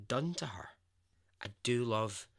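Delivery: ordinary delivery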